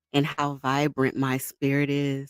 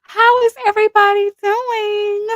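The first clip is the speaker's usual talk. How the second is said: loud greeting; 10 dB louder than usual. Real, not acted. Greeting live viewers loudly.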